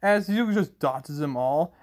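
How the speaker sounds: ordinary delivery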